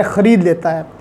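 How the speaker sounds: normal talking voice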